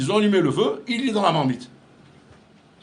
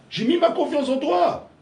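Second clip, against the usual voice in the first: friend scolding a betrayal, hurt and raised; reproachful friend; 11 semitones higher, 5 dB louder than usual. Retold or imitated. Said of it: "tu reproches ça à un ami en lui disant".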